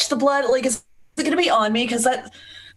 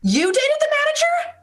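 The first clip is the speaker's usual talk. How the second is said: shocked disbelief; 14 semitones higher, faster than usual. Acted. Mock outrage over the manager.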